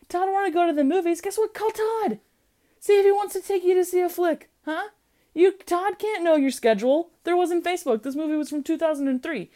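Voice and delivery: high-pitched, exasperated mock coaching; mock nagging advice. Podcaster talks at Erica about calling Todd, high mock nagging. Mock-scolding a movie character.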